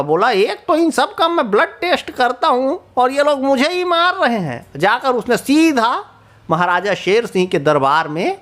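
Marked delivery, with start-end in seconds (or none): 0.3-4.7 higher, indignant complaint; a touch of a huffy mosquito; annoyed mosquito. Acted. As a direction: grumble it like you've been wronged. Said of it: "तो मच्छर बहुत नाराज़ हुआ".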